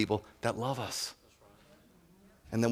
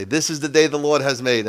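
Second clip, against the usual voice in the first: a louder, fake-cheerful churchy greeting; mock cheerful; 5 semitones higher, 7 dB louder than usual. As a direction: put on a beaming facade as you say it. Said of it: "put on a facade and smile and say".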